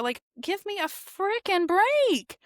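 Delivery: high, slow, drawn-out exasperation; fed up, leaning on every word; exasperated. Real, not acted. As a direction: scoff with stretched-out disbelief. Scoffs at the feigned ignorance.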